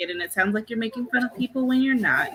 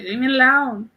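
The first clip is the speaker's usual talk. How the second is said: dog's voice; 5 semitones higher, 4 dB louder than usual. Retold or imitated. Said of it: "she's like".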